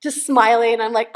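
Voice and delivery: ordinary talk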